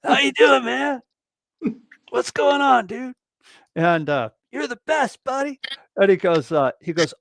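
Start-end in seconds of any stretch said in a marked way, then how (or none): 0.0-3.1 high, upbeat, gushing buddy voice; Greg impression. Greg greeting his drummer friend, high and gushing. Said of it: "You know how he is". 4.5-5.6 high, friendly voice, pitched up; Greg impression. Greg praising his friend, high and friendly. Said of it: "You know how he is".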